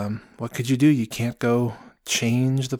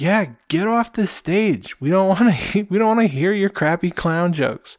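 mock the clown with a laugh; heckler jeer; 7 semitones higher, 4 dB louder than usual. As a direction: heckler telling the clown to leave, jeering. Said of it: "one of the voices that heckled him".

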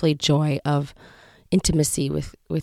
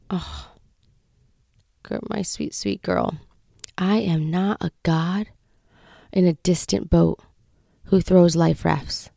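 God's tender reply. Retold or imitated. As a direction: gently soothe a beloved child, unhurried. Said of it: "where God said".

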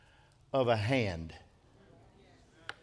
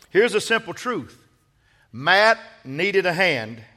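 loud, raised-pitch sermon delivery; forceful preaching; 4 semitones higher, 7 dB louder than usual. Real, not acted. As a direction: drive the point home to a congregation.

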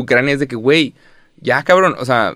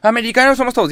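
mock indignant retort, pitched much higher; angry people quoted; 12 semitones higher, faster than usual. Quoting people who get angry, in mock indignation. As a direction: play the offended patriot insisting. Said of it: "hay gente que se enoja".

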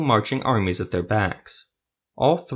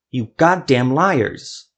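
slow, loud, higher furious yell at the liars; furious shout; 4 semitones higher, 5 dB louder, slower than usual. Acted. Nulith yelling at the three who broke their word, furious. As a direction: spit each word out in rage.

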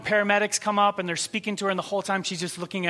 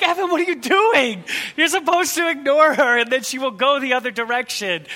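exasperated; 6 semitones higher, 5 dB louder than usual. Retold or imitated. Friend greets the drunk stranger.

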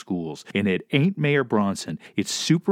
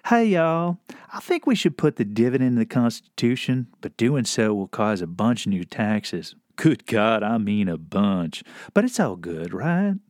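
dubbed southern voice; 2 semitones lower than usual. Acted. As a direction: sell it gently in a folksy Southern accent. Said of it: "dub all of the angry voices with this exquisite".

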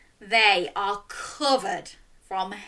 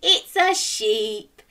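usual speaking voice

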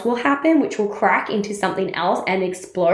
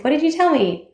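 higher, teasing repeat; girlfriend asking; 7 semitones higher than usual. Retold or imitated. Girlfriend teasingly asking again, pitched higher. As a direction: tease her with the question. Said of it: "She's like".

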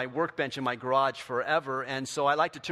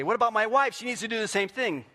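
protesting husband; 9 semitones higher, 7 dB louder than usual. Retold or imitated. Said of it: "you're gonna say".

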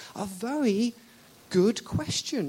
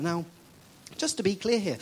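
usual speaking voice

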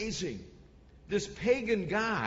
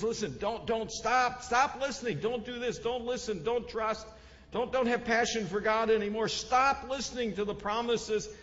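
higher, insistent volley of commands; acted urgent shout; 5 semitones higher, 3 dB louder than usual. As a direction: play a commander hammering orders at a crowd. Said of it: "he shouted in Hebrew".

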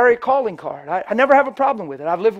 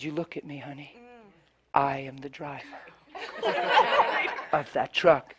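soft, tender, with a laugh; gentle reveal; 4 semitones lower, 8 dB quieter than usual. Retold or imitated. Preacher telling the woman he owns the truck, soft and tender. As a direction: tell her kindly it is your truck.

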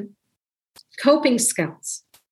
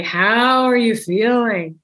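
slow, deliberate, overly earnest check-in question; stiff formal question; 3 dB louder, slower than usual. Shown as a demonstration. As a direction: ask it slowly, like a formal interview. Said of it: "You don't have to say".

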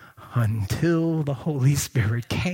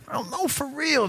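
usual speaking voice

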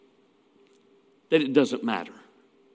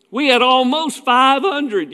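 higher, slow, drawn-out mock brag; escalating mock boast; 6 semitones higher, 4 dB louder than usual. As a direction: top the last boast, stretching it out. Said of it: "You know, we keep going".

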